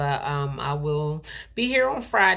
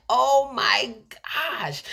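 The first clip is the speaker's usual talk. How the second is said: slow, high, stunned "oh my gosh"; drawn out as if overwhelmed; tearful wonder, much higher; awed disbelief; 8 semitones higher, 3 dB louder, much slower than usual. Retold or imitated. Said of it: "And I was like".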